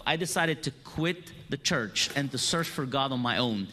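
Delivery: ordinary talk, nothing marked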